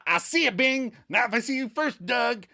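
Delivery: high, mocking toady banter ending in fake laugh; mock a suck-up's chummy banter and annoying laugh; Chandler's work laugh. Monica mocking Chandler's act around his boss, high-pitched. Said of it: "Monica mocks his annoying laugh".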